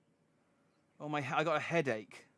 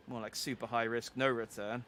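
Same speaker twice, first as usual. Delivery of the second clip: lower, deflating comeback; deadpan retort; 3 semitones lower than usual. Acted. Listener undercuts Daniel's risky motto, low deadpan retort. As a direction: puncture the boast with an understated reply.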